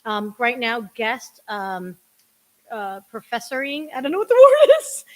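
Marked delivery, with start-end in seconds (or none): 3.9-5.0 high, fast giggling through the words; giggly aside mid-sentence; laughing. Real, not acted. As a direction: laugh at your own fumble. Laughs at own word.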